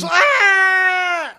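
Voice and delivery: high, loud comic yell; shouted. Real, not acted. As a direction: belt it out like a battle cry. Shouting the web address in jest.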